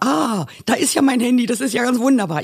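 high, delighted cry of relief; thrilled to see her phone again; overjoyed. Retold or imitated. Woman finding her lost phone, high delighted exclaim. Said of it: "Ich war so glücklich".

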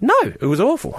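high, emphatic retold protest; lightly acted stubborn objection; mock refusal. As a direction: dig in as the indignant manager. Manager refuses to pay, acted argument.

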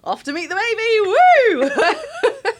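high, slow, laughing mock cheer; giddy cheer. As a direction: sing it out like a jaunty march to meet the baby. Jaunty walk to theatre.

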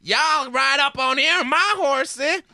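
loud, high, slow cowboy twang; hammed-up American Western accent. Shown as a demonstration. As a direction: holler it like a mock cowboy, drawn out. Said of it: "let me do my accent montage".

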